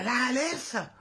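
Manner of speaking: high hissed reply; put-on serpent voice; snake hiss. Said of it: "بنفس الفحيح".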